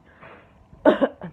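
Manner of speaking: loud racking cough; harsh cough. Real, not acted. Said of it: "This is what I mean when I say".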